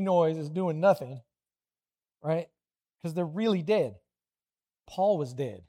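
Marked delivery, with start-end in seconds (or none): none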